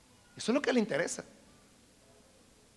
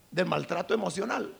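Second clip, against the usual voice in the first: ordinary talk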